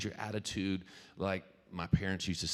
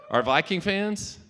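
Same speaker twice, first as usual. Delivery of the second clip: higher and louder, slow, calling out across the room; rally the crowd with a question; 12 semitones higher, 8 dB louder than usual. Real, not acted. A speaker calling out to the audience, loud and slow.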